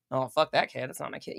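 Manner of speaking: low, soft, dismissive mutter; a low, hushed, uncaring aside; callous passer-by. Retold or imitated. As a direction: brush the crying kid off coldly.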